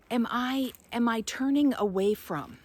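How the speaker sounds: ordinary talk, nothing marked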